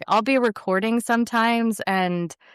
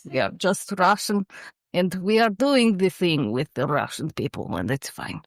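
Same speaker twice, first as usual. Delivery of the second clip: put-on Russian accent; deadpan Russian accent. Shown as a demonstration. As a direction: slip into a thick Russian accent. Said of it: "You do Russian well".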